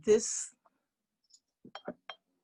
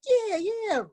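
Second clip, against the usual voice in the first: high, eager double yeah; agreeing animal friends; 13 semitones higher than usual. Acted. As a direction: pipe up in keen agreement. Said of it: "the three of them were like".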